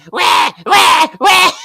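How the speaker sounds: loud, high hammed-up wailing; mock baby cry. Acting out baby Parker's reaction, mock baby cry. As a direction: wail like a baby, exaggerated. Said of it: "And the baby's like".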